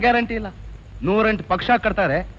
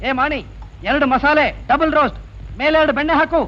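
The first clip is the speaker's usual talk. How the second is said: raised-pitch order to the waiter; calling out; 7 semitones higher than usual. Acted. Customer ordering butter masala dosas, calling out high. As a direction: call the order across, pitched up.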